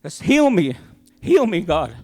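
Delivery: high, loud desperate cry for help; yelling to God. Retold or imitated. Driving home sick, he yells out to God to heal him, a high loud plea. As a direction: shout the plea up to the sky. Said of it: "I yelled out to God".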